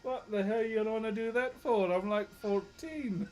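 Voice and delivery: high, loud comic protest; mock outrage. Streamer plays fourteen-year-old boy answering villain, mock outrage. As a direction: protest incredulously, stretching the last words. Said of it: "try and reenact the chat".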